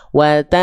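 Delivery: "Wata" with a long hum, tongue hovering and not closing; concealed n before s. Shown as a demonstration. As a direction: hold a masked n at the end of "Wata". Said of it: "belum mengarah ke gigi seri bawah".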